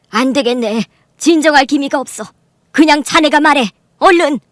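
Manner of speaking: loud order, rising in pitch; impatient command. Acted. Mother pushing her son-in-law to speak, impatient. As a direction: press him to speak up now.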